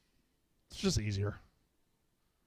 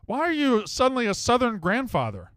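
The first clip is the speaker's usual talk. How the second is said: incredulous; 7 semitones higher, 5 dB louder than usual. Real, not acted. Host baffled by caller's voice.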